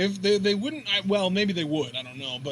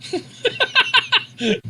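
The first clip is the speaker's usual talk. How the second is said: high-pitched cackling laugh; burst of laughter; 4 dB louder than usual. Real, not acted. Host cracking up at the co-host's line, high laughter. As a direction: crack up loudly.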